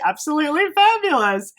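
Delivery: high, showy catchphrase delivery; campy tagline. Real, not acted. Reviewer hamming up her tagline for viewers. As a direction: sell it like a signature line. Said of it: "that's my tagline".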